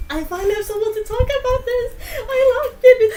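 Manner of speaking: freaking out fangirl. Acted. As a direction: burst out like a thrilled fan. Character freaks out over fan.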